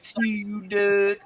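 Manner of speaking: imitating a Baltimore accent, slow and high; mock Baltimore accent. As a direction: drawl "Doug" like a Baltimore woman. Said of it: "Lord, this accent".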